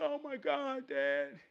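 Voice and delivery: put-on high-pitched boy's voice; shocked son. Son reacting to his father's news, shocked. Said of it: "He said".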